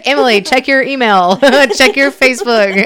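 giggling bossy command; laughing mock order. Laughing while bossing her friend around the email. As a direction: tease her through laughter.

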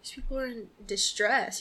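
usual speaking voice

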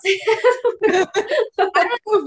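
words spilling out through laughter; laughing. Real, not acted. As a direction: laugh at your own admission. Laughing at spoiler admission.

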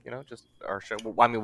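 normal talking voice

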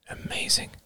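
breathy whisper to himself; whispered thought. A narrator's reading. Inner thought.

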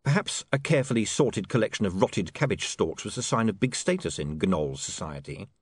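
ordinary delivery

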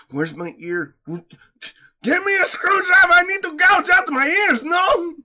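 put-on French accent jumping high and loud; hammed-up Frenchman in agony, French accent; mock French accent. A Frenchman hearing this accent, loud mock French outrage. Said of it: "a Frenchman is going".

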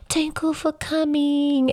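put-on high, slow little child voice; toddler voice. Toddler thanking the crowd, high and slow. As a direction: speak like a careful three-year-old. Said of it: "you hear his three-year-old voice".

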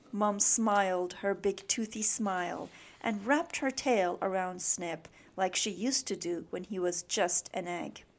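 usual speaking voice

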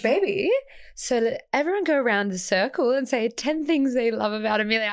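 lightly posh, coaxing host voice; gently posh friend rounding up the group; posh friend impression. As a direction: friend proposing the birthday game, lightly posh voice. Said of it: "She's so cute and posh".

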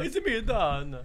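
sing-song mock-Italian greeting; cartoonish Italian accent. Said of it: "why you sound Italian in my impression".

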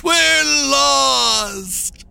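high, drawn-out wail; despairing cry. A narrator's reading. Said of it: "they cried".

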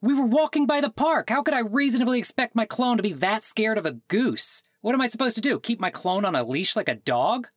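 outraged disbelief. Acted. Caller outraged at liability.

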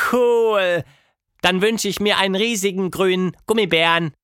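hohe Mädchenstimme, lauter; Tilda, begeistert. A narrator's reading. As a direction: freudig wünschen. (Tilda wünscht sich begeistert einen Gummibären)

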